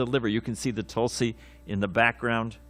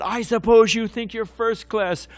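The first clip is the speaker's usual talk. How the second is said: higher, louder mock heckler's shout; angry heckling shout; 9 semitones higher, 6 dB louder than usual. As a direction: imitate an angry student heckling the speaker. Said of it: "stood up and began shouting".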